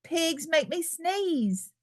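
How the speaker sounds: mildly mournful storybook line; glum lament. Acted. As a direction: sigh it out like a sad child. Gerald tells doctor his woe.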